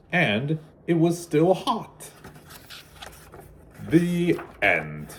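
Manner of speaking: storybook read-aloud, gentle and lively; children's storybook narration. A narrator's reading. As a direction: read it like bedtime story time for kids. Reading picture book to children.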